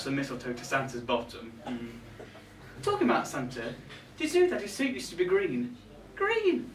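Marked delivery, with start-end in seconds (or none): 6.2-6.7 much higher, aghast at the thought; shocked exclamation. Acted. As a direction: blurt it out in disbelief. Shocked at green suit.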